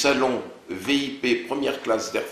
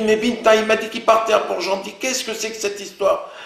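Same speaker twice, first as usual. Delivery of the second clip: higher, louder, rushed alarm; alarmed disbelief; 11 semitones higher, 6 dB louder than usual. Retold or imitated. On the phone to Binta, stunned and loud. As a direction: cry out that it makes no sense.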